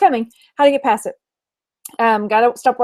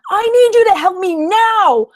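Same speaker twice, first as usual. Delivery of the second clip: high, loud all-caps demand; mock yell; 7 semitones higher, 4 dB louder than usual. Reading an all-caps message aloud, a mock yell. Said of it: "I just yelled at you".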